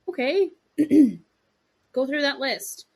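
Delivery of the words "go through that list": ordinary delivery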